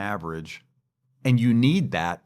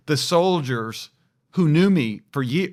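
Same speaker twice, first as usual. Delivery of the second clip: ordinary talk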